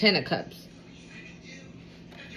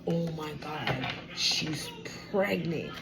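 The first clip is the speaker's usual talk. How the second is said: much softer, slow, stunned murmur; stunned hush; 5 semitones lower, 9 dB quieter, slower than usual. Retold or imitated. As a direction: breathe it out in shock. Stunned partner realising pregnancy.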